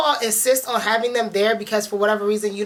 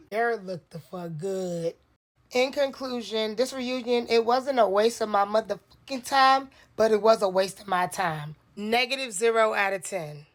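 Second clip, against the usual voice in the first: normal talking voice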